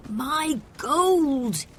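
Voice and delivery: high, loud upset cry; anguished shout. A narrator's reading. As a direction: cry out over lost treasure. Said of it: "he shouted".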